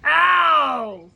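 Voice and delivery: very loud, high howl of pain; pained yell. A narrator's reading. Tiger bonked with a suitcase, yelling in pain. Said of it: "The tiger yelled".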